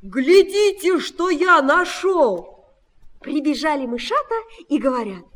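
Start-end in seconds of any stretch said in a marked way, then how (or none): 0.0-2.6 loud, high excited call. A narrator's reading. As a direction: call them over to show off a find. Rooster calls mice to see.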